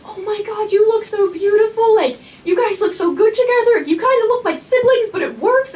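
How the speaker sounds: high, excited gushing friend voice. Retold or imitated. Old friend gushing at prom pictures, high and excited. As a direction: gush over her like an overexcited old friend. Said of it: "she comes up to me and she goes".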